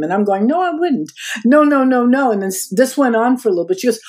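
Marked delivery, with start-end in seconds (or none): 0.5-2.3 high, quick no no no; brushing off a pushy boss with rising protest; mock protest. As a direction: protest in a raised, higher voice. Retold refusal.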